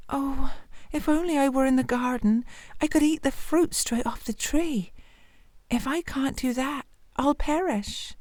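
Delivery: long for the fruit, almost to yourself; longing hungry girl. Acted. Hungry girl's longing thought.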